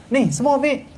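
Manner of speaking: high-pitched brusque command; habitual cross ordering. Acted. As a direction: act the warden ordering out of habit. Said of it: "terbawa-bawa perangai tu". (lakonan warden mengarah garang)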